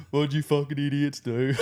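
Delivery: mock scold while laughing. CSM greets the returning soldiers, laughing.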